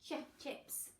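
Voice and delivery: Ch, ch, then Chips, soft repeated ch bursts; segmented ch. Shown as a demonstration. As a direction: break the ch off before Chips. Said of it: "It's this side".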